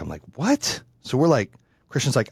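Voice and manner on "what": high, rising what; retold surprise. Husband retelling his reply to wife's call, high surprised question. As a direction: re-enact disbelief with a jump up in pitch.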